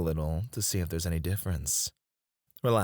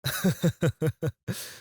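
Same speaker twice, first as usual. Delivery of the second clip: rippling, higher-pitched chuckle; pleased chuckle; 8 semitones higher than usual. Acted. Boss revealing assistant's favourite dish, pleased chuckle. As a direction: chuckle at pulling off a surprise.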